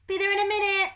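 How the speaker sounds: high, loud call to the door; bellowing. A narrator's reading. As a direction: shout it across the house. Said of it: "he bellowed".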